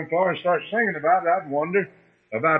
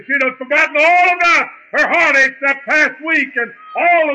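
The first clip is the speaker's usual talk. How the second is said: much higher, louder climactic preaching; preaching at full force; 8 semitones higher, 4 dB louder than usual. Acted. Preacher telling how his mother was lifted up, preached high and loud. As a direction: soar it out like the spirit lifts you.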